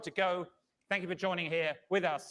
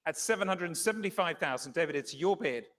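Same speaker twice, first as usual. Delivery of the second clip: brisk, measured bid calling; fine art auctioneer; 7 dB louder than usual. Acted. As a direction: call the bids steadily, room to room.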